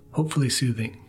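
ordinary delivery

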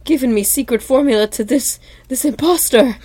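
high-pitched mock outrage; indignant confrontation. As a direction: act out an appalled accusation. Acted outraged Mister Krabs confrontation.

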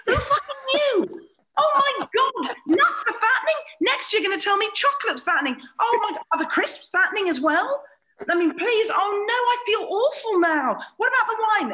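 high, loud sarcastic fake shock; mock horror. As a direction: gasp in exaggerated horror, mocking them. Mock shock at being told.

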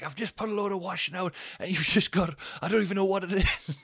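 high, exasperated mock shout; retold shouting. Bloke shouting up about soaked washing, high exasperated protest. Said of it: "he's shouting at us".

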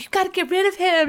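lightly voiced insistent girlfriends; mock friends insisting. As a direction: press her like exasperated friends. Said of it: "all the girls were like".

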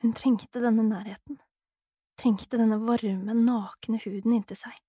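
usual speaking voice

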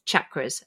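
"chakras" begun with CH, like "chocolate". Shown as a demonstration. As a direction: say "chakras" as CHAK-ruhz. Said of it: "if it's chocolate".

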